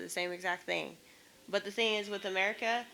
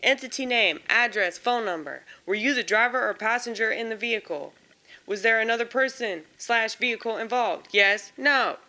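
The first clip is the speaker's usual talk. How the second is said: louder, brisk bureaucratic read of form questions; form read aloud; 2 semitones higher, 7 dB louder than usual. Acted. Vlogger reading each insurance form question aloud, exasperated formal read. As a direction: rattle off the questions with growing exasperation.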